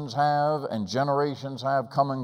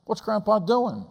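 put-on high, innocent child asking; a small boy tugging at his mom, higher; little boy's voice; 9 semitones higher than usual. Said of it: "I tugged my mom's skirt".

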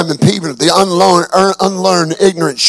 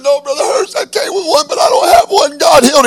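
choked-up, much higher, near crying; tearful testimony; 11 semitones higher than usual. Retold or imitated. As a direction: voice cracking high with tears. Healed man answers the preacher.

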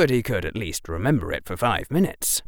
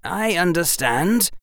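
put-on higher, controlled boyish reply; resentment held back; 9 semitones higher than usual. A narrator's reading. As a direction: accept it without showing resentment. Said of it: "kept the resentment out of his voice".

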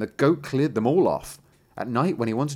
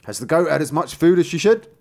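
loud, raised questioning voice; demanding old tailor; 6 semitones higher, 8 dB louder than usual. Acted. Old tailor asks his son about the goat, loud demand. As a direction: quiz your son loudly and high.

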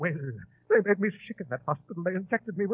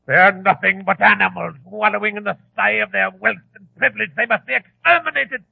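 louder, building tirade; ranting fury; 2 semitones higher, 8 dB louder than usual. Acted. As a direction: whip up hatred as he goes. Ranting against the impostors.

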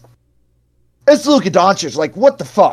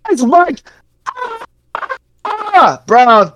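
scream; 12 semitones higher, much slower than usual. Acted. Host screams the team name, voice cracking high. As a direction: break into a screech mid-name. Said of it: "You really cut out badly on your scream".

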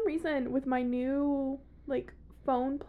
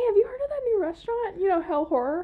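higher, louder, a mock pitch broken by laughter; laughing; 7 semitones higher, 5 dB louder than usual. Pitching a joke restaurant to her co-host while laughing. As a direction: barely hold it together through the joke.

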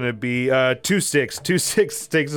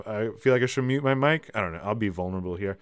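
normal talking voice

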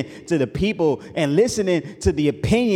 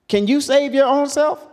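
much higher, louder preached questions; God's challenge; 9 semitones higher, 6 dB louder than usual. Acted. God asking Job if he was there at creation, loud and high. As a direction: hurl the questions at Job like thunder.

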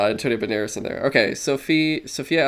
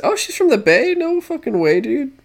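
surprised; 13 semitones higher than usual. Real, not acted. Surprised discovery while searching.

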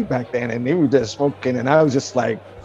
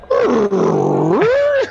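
high burst of laughter. Real, not acted. Stunned at [name] knowing him.

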